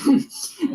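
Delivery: laugh. Real, not acted. Laugh after mocking.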